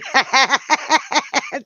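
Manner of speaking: bright laugh at her own slip; amused laugh. Real, not acted. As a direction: laugh at the mistake.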